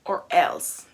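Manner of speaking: slow, loud stern warning; stern teacher threat. Retold or imitated. Teacher threatening the defiant student, slow and loud. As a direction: lay down the law, slowly.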